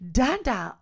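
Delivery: a hint of a child's high-pitched babble; imitating toddler Maddie. Sister's toddler repeating a word in bed, lightly imitated child voice. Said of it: "Maddie is sitting up and saying".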